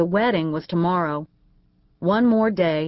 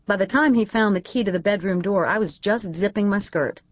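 plain reading voice

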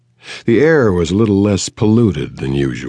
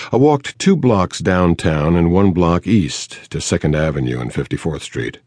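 ordinary delivery

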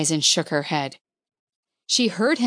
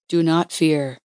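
lightly voiced stranger, low and gentle; calm the frightened woman softly; a hint of a low, soothing man; low soft male voice. A narrator's reading. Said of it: "The male voice was low and soft".

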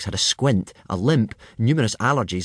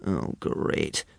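whispered, lower under his breath; gloomy thought; 6 semitones lower, 6 dB quieter than usual. A narrator's reading. Hiccup thinks to himself in gloomy dread, whispered. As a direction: sigh it to yourself in dread. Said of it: "thought Hiccup gloomily".

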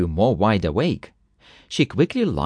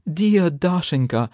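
mild pleading moan, pitched up; 6 semitones higher than usual. A narrator's reading. Husband begs his wife for help, moaning. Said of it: "moaned Strizhin".

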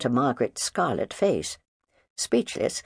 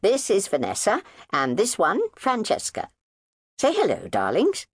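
breezy mother; 10 semitones higher than usual. Acted. Mother presenting her little girls, breezy and high. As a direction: show off the girls proudly.

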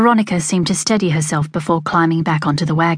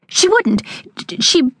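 much higher, sputtering protest; flustered anger; 11 semitones higher, slower than usual. A narrator's reading. Veronica, cornered about her mother, sputtering angrily. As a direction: lose the thread mid-protest. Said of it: "swatted angrily".

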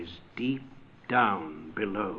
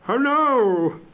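much higher, louder startled call; cried out; 12 semitones higher, 7 dB louder than usual. Acted. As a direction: fling the greeting out at the ghost. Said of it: "cried Scrooge".

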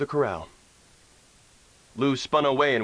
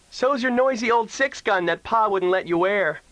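put-on high, pert kid sister voice; little girl voice; 10 semitones higher, 4 dB louder than usual. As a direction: tease him like a bratty little sister. Leads Oda Lee's voice.

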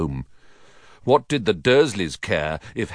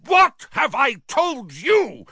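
very high, slow furious shout; thundering; slower than usual. A narrator's reading. Uncle exploding at his nephew, thundering. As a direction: thunder each word in outrage. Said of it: "thundered his uncle".

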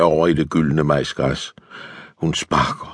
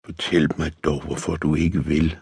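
softly pleading, held back; hushed plea, understated; subdued pleading; 7 dB quieter than usual. A narrator's reading. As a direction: beg in a lowered voice. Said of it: "tigger løjtnanten dæmpet".

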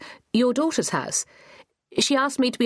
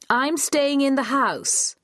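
"I'm staying in the house" raised high and slow, stressing staying; shouting; 8 semitones higher, slower than usual. A narrator's reading. Thea repeating herself to deaf Granny, raised and slow. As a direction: call it out slowly to a deaf old woman. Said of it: "Thea heard herself shouting".

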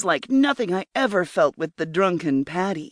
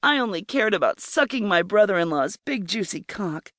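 plain reading voice

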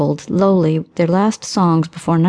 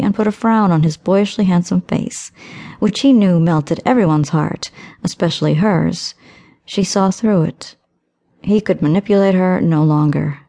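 ordinary delivery, nothing marked

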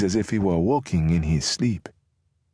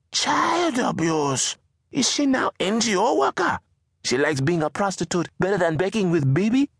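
mock the girl's phrase in disbelief; a mother scoffing at her daughter's excuse; incredulous Mama; 12 semitones higher than usual.

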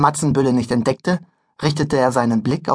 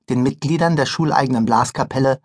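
ordinary delivery, nothing marked

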